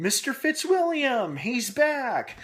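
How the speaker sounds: high, drawn-out, excited announcement; excited reveal. Real, not acted. Reviewer revealing a returning character to viewers, excited and high.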